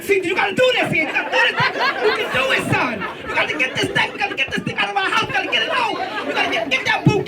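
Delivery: frantic mom urging her son on; urgent coaxing. Retold or imitated. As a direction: talk him into it in a fast, high voice. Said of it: "I had to try to convince him".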